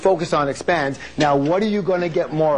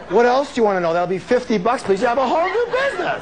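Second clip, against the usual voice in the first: high-pitched hammed-up patter, climbing to a squeal; comic pitch that builds higher to the last word; mock psychic sales pitch; 8 semitones higher, faster than usual. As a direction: play the showman, ending way up high. Acted fortune-teller comeback to friend.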